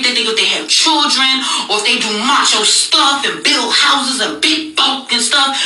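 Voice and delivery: ordinary talk, nothing marked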